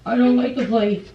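ordinary talk, nothing marked

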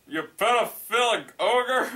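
high, loud accusation; hammed-up indignant name-calling; outraged father. Acted dad's outrage continues.

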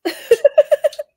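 loud, high laugh. Real, not acted. Amused reaction while streaming.

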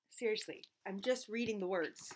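plain reading voice

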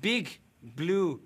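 put-on broken English with a German accent. A German-accented interviewer greets his guest politely. Fake foreign interviewer throughout.

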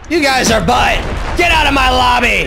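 taunting shout. Real, not acted. Taunting the losing lobby.

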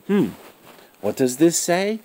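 plain reading voice

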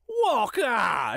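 put-on high, disbelieving street-boy cry; boy's exclamation. A narrator's reading. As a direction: call it out high like a scoffing lad. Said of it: "exclaimed the boy".

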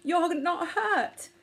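high, drawn-out amazed question; crying out. A narrator's reading. Poppet to the mouse he thought was dead, crying out. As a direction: cry it slowly in rising concern. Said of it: "cried Poppet".

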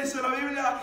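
ordinary delivery

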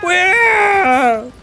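high startled where; alarmed. Real, not acted. Gamer yelling at escaping enemy troops. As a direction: shout the question in disbelief. Losing battle in strategy game.

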